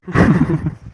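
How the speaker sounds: short burst of laughter. Acted. Uncle and guests joking about overeating, short burst of laughter. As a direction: laugh out at the joke. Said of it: "என்ன ஒரே சிரிப்பு சத்தமா இருக்கு".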